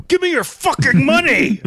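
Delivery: very high, loud cartoon-bunny yell; shouted cartoon demand. Muggs Bunny demanding money from a victim, shrieking.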